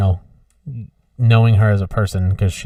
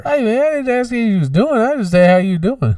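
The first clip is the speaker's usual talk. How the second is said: high, put-on comedian voice; Tony Woods griping high; Tony Woods impression; 12 semitones higher than usual. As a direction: complain like Tony Woods, pitched way up. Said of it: "That is the Tony Woods I'm thinking of".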